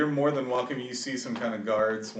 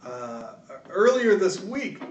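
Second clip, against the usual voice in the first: 1 eager guard; 8 semitones higher, 4 dB louder than usual. Acted. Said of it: "Guardsman Roy goes".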